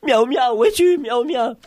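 high-pitched searching call; appel inquiet. Acted. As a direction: call out for a lost companion. Papa appelle Miaou Miaou. (appel aigu, inquiet)

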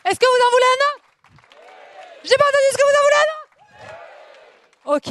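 very high, loud teasing squeak; high squeaky voice. Acted. Comedian teasing the audience for another, high squeak. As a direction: squeak the question at the crowd.

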